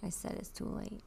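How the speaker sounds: hissed playful refusal; whispered insistence. Acted. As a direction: whisper it through a grin.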